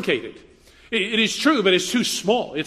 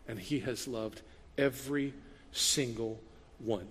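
softly, slowly, lower, with tender weight; 5 semitones lower, 8 dB quieter, slower than usual. Real, not acted. Pastor tells congregation God loves everyone, tender and slow. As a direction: let the love sink in gently. Tender stress on God's love.

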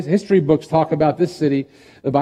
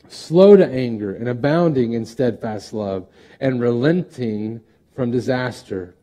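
raised, ringing Bible reading, slowing at the end; scripture reading; 2 semitones higher, 4 dB louder than usual. Acted. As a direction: read Jonah's plea out with weight. Preacher reads Jonah's prayer.

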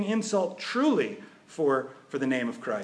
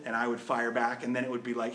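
normal talking voice

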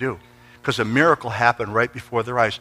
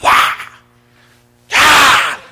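high, loud mock scream; imitated high, loud scream; woman screaming; 13 semitones higher, 7 dB louder than usual. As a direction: wail like a wild woman. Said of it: "she's going like this".

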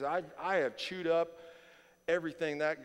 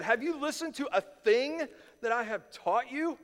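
higher, louder exasperated rebuke; mad Jesus; 9 semitones higher, 6 dB louder than usual. Retold or imitated. Jesus rebuking his disciples, exasperated and loud. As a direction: scold like a fed-up teacher. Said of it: "He's mad".

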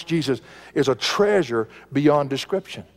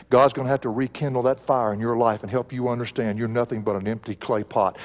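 ordinary talk

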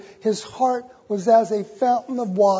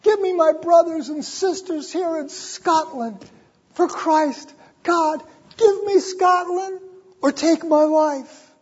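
Knox's prayer; 7 semitones higher than usual. Retold or imitated. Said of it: "Her husband saying".

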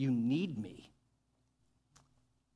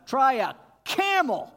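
much higher, loud mocking shout; 10 semitones higher, 11 dB louder than usual. Real, not acted. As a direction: yell the absurd dare. Preacher shouts mocking challenge.

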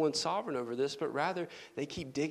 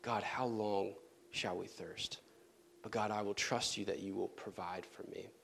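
soft, lower, pleading prayer; quiet prayer; 6 semitones lower, 8 dB quieter than usual. Pretend. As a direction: murmur a trusting plea to God. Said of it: "a calling out to God".

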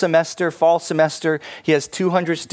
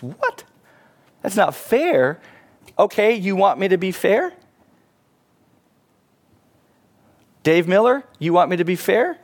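incredulous challenge; 5 semitones higher than usual. Acted. Professor challenges the protesting students.

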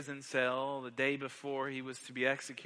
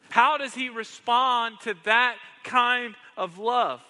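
much higher, louder, slowing on the questions; fiery pulpit preaching; preaching at full force; 8 semitones higher, 9 dB louder than usual. Acted. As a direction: hammer the questions home to the congregation.

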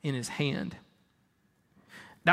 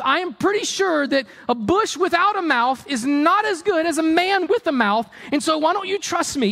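high, loud-edged incredulous rant; exasperated mock God; 10 semitones higher, 3 dB louder than usual. Preacher as God scolding Moses about the bush, exasperated mock rant. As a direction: berate him in comic disbelief.

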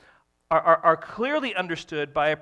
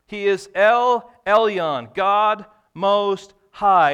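slow, loud, higher proclamation; proclaiming; 5 semitones higher, 12 dB louder, much slower than usual. Real, not acted. Preacher to congregation: loud, slow proclamation. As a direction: announce the name with weight.

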